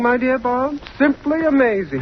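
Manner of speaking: high, sing-song fake wonder; mock amazement. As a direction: gush over it to make fun of him. Mocking the newcomer's miracle talk.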